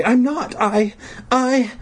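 put-on high, faltering little-girl voice; tearful faltering. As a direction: stammer high, unable to finish.

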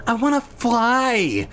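high, drawn-out whine; protesting. A narrator's reading. Mia insisting Jack teach her, whiny protest. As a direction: whine it, stretching the last word. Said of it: "Mia protested".

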